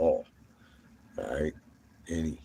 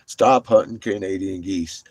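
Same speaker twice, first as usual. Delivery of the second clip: ordinary talk, nothing marked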